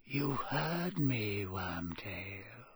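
slow, soft, dying into a whisper; hushed cold command. A narrator's reading. Master repeating an order to whimpering servant, hushed menace. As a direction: draw it out quietly, last word all breath.

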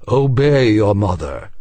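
loud parental order; stern father. A narrator's reading. Father Beleth ordering the boy, loud and stern.

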